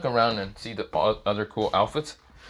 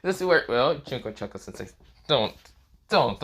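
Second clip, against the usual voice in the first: usual speaking voice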